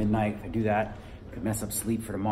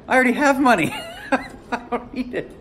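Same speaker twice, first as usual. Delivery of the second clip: laughing; 11 semitones higher, 3 dB louder than usual. Retold or imitated. Retelling his own laughing reply.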